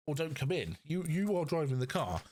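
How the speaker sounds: normal talking voice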